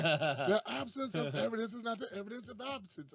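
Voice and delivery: high-pitched, put-on insistence; mock insistent recital. Character repeats his saying to baffled listeners, mock insistent.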